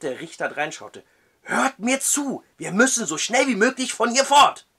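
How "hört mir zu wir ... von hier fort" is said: high, loud urgent plea; pleading urgently. Acted. Matthew begs the judge to flee, urgent. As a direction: beg him to listen.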